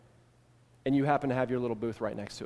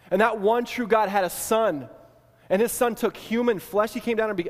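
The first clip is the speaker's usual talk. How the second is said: building preacher's indignant wonder; fiery preaching; 6 semitones higher than usual. Acted. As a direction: preach it with rising fire and astonishment. Preaching builds to full force.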